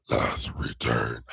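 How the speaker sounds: low slow villain voice. Shown as a demonstration. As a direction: growl it like a scheming villain. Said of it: "I sound like a real villain".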